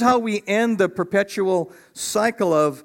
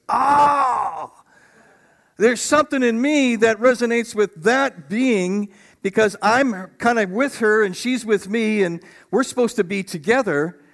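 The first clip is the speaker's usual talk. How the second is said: higher, louder cry of wonder, easing at the end; a pleased Adam, raised up in pitch and volume; awed Adam crying out; 4 semitones higher, 3 dB louder than usual. Retold or imitated. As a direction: let it burst out of you on first sight. Pastor voices Adam seeing Eve.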